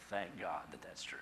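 soft, low, whispery thanks; hushed gratitude. Real, not acted. Preacher giving thanks that it's everyone, hushed. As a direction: let it sink almost to a whisper.

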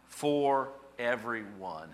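"for everyone" drawn out slowly; deliberate reading. Real, not acted. Preacher reading the last phrase, deliberate. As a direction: read "for everyone" word by word, very slowly.

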